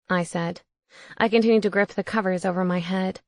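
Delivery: usual speaking voice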